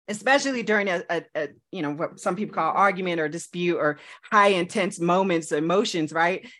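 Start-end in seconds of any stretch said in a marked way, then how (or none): none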